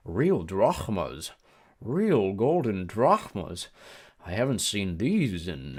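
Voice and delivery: very slow, greedy British voice; lingering, covetous British drawl; British-like accent. A narrator's reading. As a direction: savour each word in a British accent. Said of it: "He had a strange accent. British maybe".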